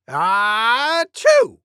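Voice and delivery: loud acted sneeze. Cuckoo demonstrates a sneeze, loud. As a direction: show off a big sneeze. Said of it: "Maybe as loud as this".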